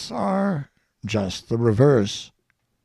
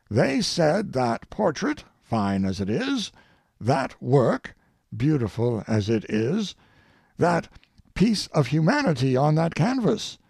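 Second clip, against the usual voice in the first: ordinary talk, nothing marked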